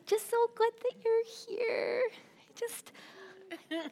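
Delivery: tiny, very high squeal of affection; gushing welcome. Shown as a demonstration. Voicing a wordless smile for the audience, gushing welcome. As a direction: squeak it sweetly in a high little voice. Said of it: "I want you to communicate to them".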